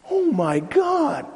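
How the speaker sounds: high, drawn-out "oh my God"; dismayed exclamation. Real, not acted. Preacher reacting to what schools teach, high dismay.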